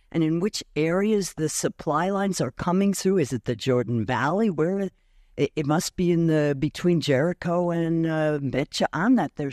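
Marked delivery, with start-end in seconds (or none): none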